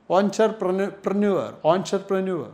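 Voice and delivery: "Entrepreneur" begun with a rounded "on" sound; correct pronunciation. Shown as a demonstration. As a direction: say "Entrepreneur" as "ontrepreneur", carefully. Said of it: "The pronunciation is absolutely wrong".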